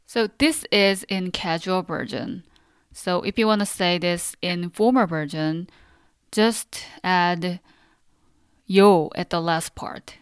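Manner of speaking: ordinary talk, nothing marked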